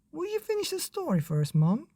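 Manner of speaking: put-on high, fast eager boy; high child begging mum. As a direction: plead like a child.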